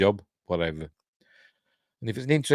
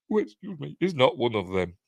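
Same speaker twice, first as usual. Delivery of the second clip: burping mid-sentence; talking while burping; 3 semitones higher than usual. Real, not acted. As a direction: speak through a burp. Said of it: "Sorry, I'm burping".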